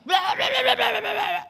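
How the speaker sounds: high, loud imitated gibberish shrieking; possessed screaming babble. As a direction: mimic a sudden wild babbling scream. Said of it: "tiba-tiba dia teriak". (teriakan meracau kesurupan)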